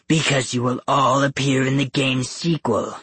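lightly gruff monster, slowing for the title; booming beast. A narrator's reading. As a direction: announce it ominously as the Beast. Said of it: "His deep voice thundered".

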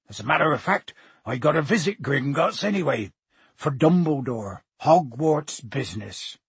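big-voiced giant warning a boy; Hagrid, earnest warning. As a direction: warn heartily, then turn self-important.

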